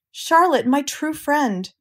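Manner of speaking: high, drawn-out moan; slow, high lament for a friend. A narrator's reading. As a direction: moan slowly, grief-stricken. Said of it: "he moaned".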